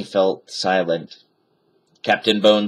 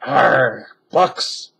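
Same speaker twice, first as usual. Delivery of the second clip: pirate growl; 3 semitones higher than usual. A narrator's reading. Pirate disgusted again.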